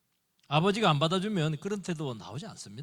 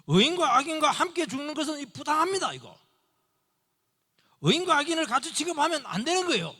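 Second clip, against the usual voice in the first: high, loud indignant plea to God; Abraham bargaining for the city, indignant; Abraham pleading; 12 semitones higher, 7 dB louder than usual. Acted. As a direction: argue with God like Abraham, fiercely. Preacher voices Abraham's plea.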